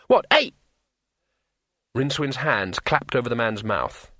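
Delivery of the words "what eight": high, louder innocent blurt; high-voiced, unsuspecting question. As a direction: blurt it out brightly and loudly, pitched up. Blurts out the forbidden number.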